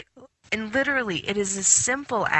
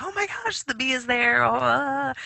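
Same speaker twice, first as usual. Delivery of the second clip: much higher, startled cry at the bee; alarmed squeal; 7 semitones higher than usual. Retold or imitated. Finding the bee in her living room, startled high squeal. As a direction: jump at the sight of the bee. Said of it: "And I'm like".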